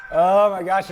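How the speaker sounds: nervous. Real, not acted. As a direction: blurt it with a jolt of nerves. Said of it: "it makes my heart nervous".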